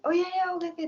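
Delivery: high-pitched retold yes; eager agreement. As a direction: re-enact agreeing readily on the phone. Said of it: "gue bilang".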